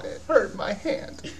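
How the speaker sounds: ordinary talk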